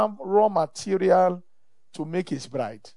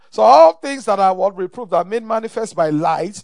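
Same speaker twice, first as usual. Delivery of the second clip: forceful preaching; 8 dB louder than usual. Real, not acted.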